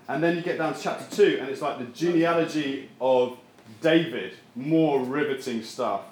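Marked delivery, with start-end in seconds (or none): none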